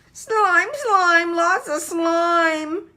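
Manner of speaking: high, loud yell, slowing at the end. A narrator's reading. Little brother yells to Mummy about the snail, high loud yell. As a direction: shout it like a grossed-out little boy. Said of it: "yelled Tate".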